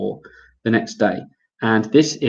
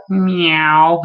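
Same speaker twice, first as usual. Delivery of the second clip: playful high cat meow, a little louder; silly meow; 7 semitones higher, 5 dB louder than usual. Pretend. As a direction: toss off a goofy kitten meow. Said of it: "I'm gonna be a bit silly".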